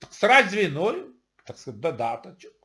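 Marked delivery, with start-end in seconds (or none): none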